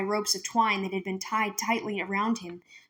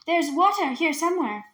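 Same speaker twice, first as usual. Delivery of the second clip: eager hope; 7 semitones higher, 4 dB louder than usual. Acted. Minley searching for water.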